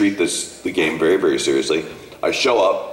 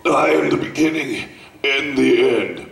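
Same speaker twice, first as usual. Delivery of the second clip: villain line retake; 4 semitones higher than usual. Acted. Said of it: "Uh, okay, sure".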